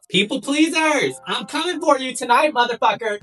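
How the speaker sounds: high, loud, slowed rallying cry; hype shout. Acted. Vlogger hyping up people pleasers, high loud shout. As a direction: yell it like a battle call.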